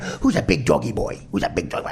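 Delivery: put-on lightly done Chicago accent, cooing at a dog; a hint of Chicago accent sweet-talking the dog; mock Chicago doggy talk. Mocking roommate in Chicago accent cooing to the dog. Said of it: "in that Chicago".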